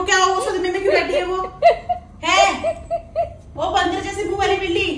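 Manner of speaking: high voice breaking into laughter; teasing the sulking cat through giggles; laughing teasing. Real, not acted.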